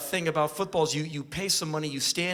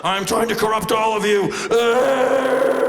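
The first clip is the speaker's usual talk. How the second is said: high, loud cartoon villain cry; hammed-up monster screech; shrieking blob monster; 6 semitones higher, 9 dB louder than usual. Pixelated blob voiced as a monster.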